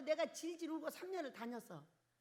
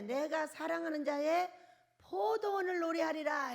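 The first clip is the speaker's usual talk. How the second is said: scripture proclaimed; 3 semitones higher, 6 dB louder than usual. Acted. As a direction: declare the verse like a herald. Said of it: "이렇게 말씀하셨어요".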